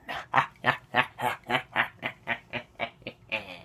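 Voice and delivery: long, evenly spaced "ha-ha-ha" cackle; put-on villain's laugh that fades out; measured, theatrical chuckle; staccato villain laugh. Mock villain laugh after quote.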